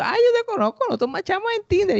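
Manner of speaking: very high, bright gotcha voice; mock girl voice, far above his own; gleeful girl. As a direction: expose him in a squeaky, gleeful tone. Girl exposing their Tinder match.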